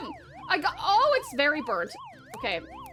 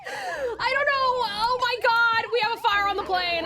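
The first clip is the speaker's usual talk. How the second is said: panicked yell; 6 semitones higher, 3 dB louder, slower than usual. Real, not acted. Kitchen fire breaks out in game.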